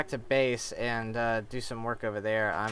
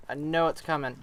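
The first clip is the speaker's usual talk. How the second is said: usual speaking voice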